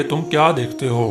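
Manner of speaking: ordinary delivery, nothing marked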